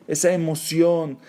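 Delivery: ordinary talk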